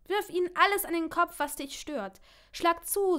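high, loud urging; forceful, rousing command; egging on. Acted. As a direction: fire them up to let the anger out. Partner eggs her on to punch.

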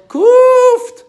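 very high, loud bark; shouted shut up. Pretend. Shouting down someone stupid at the grocery store, very high and loud. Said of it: "someone says something stupid".

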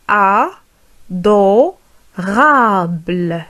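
"Adorable" split slowly as a, do, rable; very slow "Adorable" with clear gaps inside; syllable by syllable. Shown as a demonstration. Teacher modelling for learners, syllable by syllable "Adorable".

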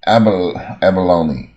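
abalone said slowly, starting with an open ab; model sound ab. Shown as a demonstration. Teacher models abalone for students, slow with an open first A. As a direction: break off at abal-, then say abalone in full, slowly. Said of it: "也是第一个A".

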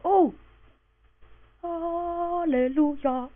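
sharp gasp, then a long, slow, high "Hallelujah"; delighted gasp. Real, not acted. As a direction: stretch Hallelujah out like a hymn. Finds more iron.

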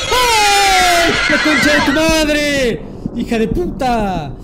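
real shocked outburst; startled scream. Real, not acted. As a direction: yell as the scare hits. Jump scare.

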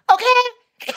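imitated squeal of agreement, way up; high-pitched okay. Clerk relaxing in front of the guest, imitated high squeak. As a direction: say it very high, mimicking her.

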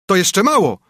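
gloating retort. A narrator's reading. Sparrow gloats over the ruined carter, high and loud. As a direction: jeer at him from above. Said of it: "odrzekł wróbel".

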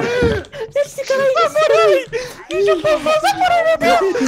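very high, loud frantic shrieking; panicked scream. Real, not acted. Panic during monster attack.

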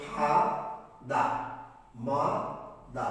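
ফা, দা, মা, দা as isolated beats; syllable by syllable. Shown as a demonstration. Teacher asking students to pronounce the parts, syllable by syllable. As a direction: say ফা, দা, মা, দা one at a time with long pauses. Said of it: "উচ্চারণ করো".

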